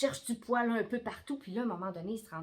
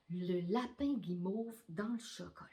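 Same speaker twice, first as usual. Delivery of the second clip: whisper; 4 semitones lower, 6 dB quieter than usual. Acted. Bedtime story hush.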